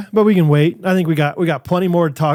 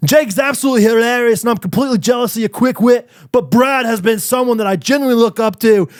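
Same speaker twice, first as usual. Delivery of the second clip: mock Australian-London reading voice; put-on Australian accent; 3 semitones higher than usual. Podcaster reading fan's compliments in put-on Australian accent. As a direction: read it in a wobbly Australian accent. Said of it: "I'm talking his accent while he says these nice things".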